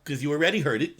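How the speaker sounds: usual speaking voice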